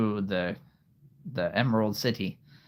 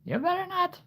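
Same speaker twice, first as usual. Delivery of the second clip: mock warning. Character warning in story.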